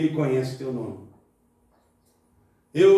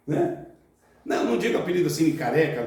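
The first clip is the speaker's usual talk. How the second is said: ordinary talk, nothing marked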